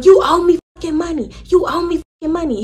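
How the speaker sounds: high mock yell at the dealer; retold yelling. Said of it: "she just started yelling".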